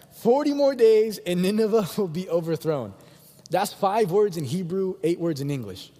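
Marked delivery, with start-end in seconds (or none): 0.1-2.9 higher, louder, with a laugh inside; amused re-reading. Acted. Preacher rereading Jonah's terrible sermon, amused and emphatic. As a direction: repeat it, tickled by how bad it is. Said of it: "Let me read it one more time".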